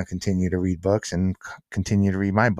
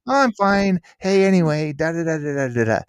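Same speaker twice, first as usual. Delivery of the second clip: higher, brushing it all off; the friend breezily changing the subject; breezy friend voice; 8 semitones higher, 4 dB louder than usual. Retold or imitated. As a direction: imitate a friend waving off worry lightly. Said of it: "And she'd go".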